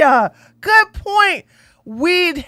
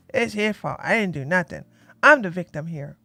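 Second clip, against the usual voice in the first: lower, much softer whiny imitation; mock narcissist excuse; 11 semitones lower, 10 dB quieter than usual. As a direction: mimic someone dodging all blame, under your breath. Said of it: "this is a narcissistic thing".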